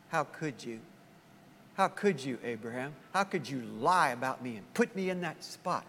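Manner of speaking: hurt reproach. Acted. Sarah reproaching Abraham.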